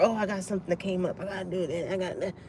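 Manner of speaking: low, soft, hurried excuse; mock brush-off. Busy friends backing out a week before, mock low brush-off. Mimics people making excuses.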